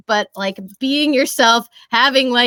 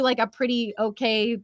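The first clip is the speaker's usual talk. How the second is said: ordinary talk, nothing marked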